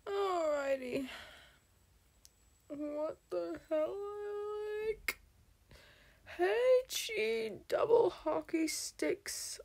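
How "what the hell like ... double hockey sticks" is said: high, drawn-out whine; mock crying. Streamer still upset over the dark mode, mock crying. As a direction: put on a tearful, high-pitched whimper. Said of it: "I'm just gonna start crying".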